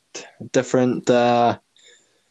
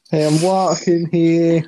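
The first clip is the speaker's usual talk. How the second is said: higher, hammed-up New York accent; attempted New York accent; 5 semitones higher than usual. Co-host riffing with the voice actor, attempted New York accent. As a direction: throw out the New Yorker's catchphrase. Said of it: "the accent is gone now".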